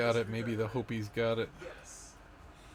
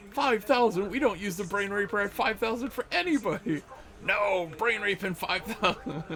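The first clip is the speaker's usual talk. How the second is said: very high, hammed-up protest; comic high-pitched indignant voice; mock aghast villain; 11 semitones higher, 4 dB louder than usual. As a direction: object in squealing pretend outrage. Acts second villain objecting, aghast.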